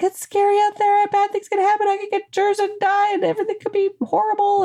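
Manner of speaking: high, monotone mock-panicked rant; freaking out. Fearful inner part frets about germs, mock freak-out. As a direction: spiral into pretend fright, high-pitched throughout. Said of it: "freaking out".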